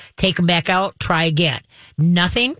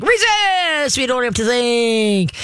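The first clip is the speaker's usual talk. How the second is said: squeaky high cartoon dog voice crying resist, then slow; mock silly dog voice; 6 semitones higher, much slower than usual. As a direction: ham up a wound-up dog. Dog overexcited by good boy.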